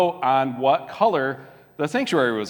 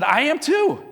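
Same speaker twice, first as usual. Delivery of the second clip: high-pitched mock delight; excited agreement; 10 semitones higher, slower than usual. Joke's rescuer hears he is a Baptist, mock excitement. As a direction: leap up in pitch, eager to agree.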